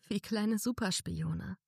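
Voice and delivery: slow, smiling remark. A narrator's reading. Narrator teasing Daisy fondly, smiling slowly. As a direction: say it with an audible smile. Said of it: "sagte ich lächelnd".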